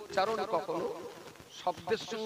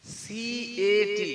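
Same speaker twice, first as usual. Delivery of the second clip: each letter drawn out very slowly; slow spelling; much slower than usual. Shown as a demonstration. Speaker spelling CAT for listeners, very slowly.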